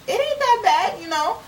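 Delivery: high, light voice for the slave's answer; mock slave quote. Retelling the slave's reply to the filmmaker, high and casual. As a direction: pitch it way up and shrug it off. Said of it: "And he's like".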